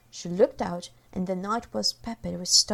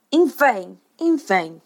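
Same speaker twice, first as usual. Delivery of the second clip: cried out; 6 dB louder, slower than usual. A narrator's reading. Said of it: "he cried".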